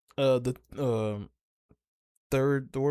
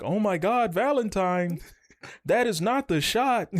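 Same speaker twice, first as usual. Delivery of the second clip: exasperated yell; 7 semitones higher than usual. Retold or imitated. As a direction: cry out at the terrible shot. Mocking a miss, exasperated.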